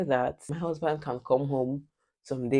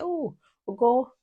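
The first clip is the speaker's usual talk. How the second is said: mom calling; 9 semitones higher than usual. Retold or imitated. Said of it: "she'll be like".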